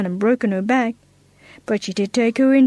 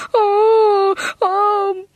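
high sobbing oh; crying oh; 10 semitones higher than usual. Acted. Girl stuck in tree cries to her mother, sobbing. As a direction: wail it out, near tears. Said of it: "cried poor Agatha".

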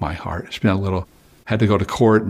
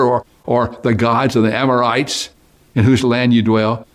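scripture reading; 3 semitones higher than usual. Acted. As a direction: proclaim the verse with weight. Reads scripture aloud.

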